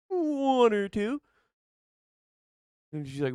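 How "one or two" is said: high, slow and drawn out; sheepish answer. Retold or imitated. Answering mom how many pills, high and slow. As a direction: stretch it out guiltily.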